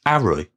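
Harry as 'Arry, starting on the vowel; dropped H. Shown as a demonstration. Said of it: "is replaced by an apostrophe".

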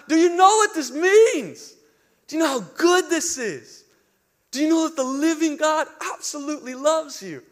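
high, loud, exultant proclamation; screaming out. Retold or imitated. Preacher wanting the huddled crowd to know, screaming out. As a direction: cry it out with urgent wonder. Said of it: "wanted to scream out".

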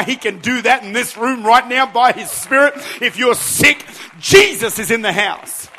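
driving list building to a loud climax; impassioned preaching. Real, not acted. Preaches at full force.

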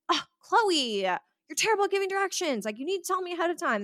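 high-pitched mock scolding; hammed-up exasperation, pitch well up; exasperated driver. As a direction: imitate a fed-up driver blaming the navigator. Imitates exasperated driver scolding her.

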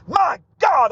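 preacher overcome with joy; excited. Real, not acted. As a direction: let the excitement burst out. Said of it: "I'm getting excited about it".

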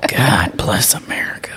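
awed whisper. Real, not acted. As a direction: breathe it out in awe. Looking at the photo.

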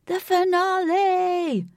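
high, loud, slow drawn-out scream. A narrator's reading. Lynx yells to everyone below, loud and drawn out. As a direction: shout it out from the hilltop. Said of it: "Lynx screamed".

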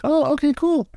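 high squeaky happy dog voice. Retold or imitated. Coach voices the dog answering its owner, high and squeaky. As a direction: squeak it out like a relieved puppy.

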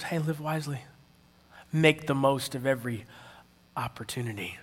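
soft, measured urging, fading at the end; quietly instruct, pausing before the last word; God's quiet counsel. Retold or imitated. God nudging an angry worker, soft and calm. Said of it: "And God goes".

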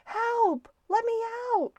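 high-pitched cry for help; frightened cry. A narrator's reading. Stuart trapped in the rolled-up window shade, frightened cry. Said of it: "cried Stuart, who was frightened".

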